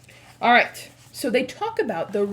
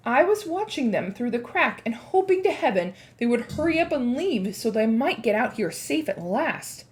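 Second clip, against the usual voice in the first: plain reading voice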